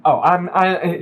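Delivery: ordinary talk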